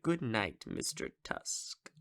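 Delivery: softly whispered goodnight, slowing down; hushed whisper. Retold or imitated. Howard bidding the fainting Wallace goodnight, eerie whisper. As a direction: breathe it out like a creepy lullaby.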